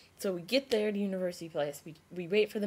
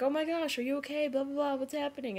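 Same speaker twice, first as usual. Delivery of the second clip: high-pitched imitation of a worried girl; fussing helper; 8 semitones higher than usual. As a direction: imitate a concerned passer-by, pitched up.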